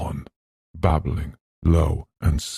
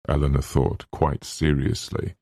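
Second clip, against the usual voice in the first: ordinary delivery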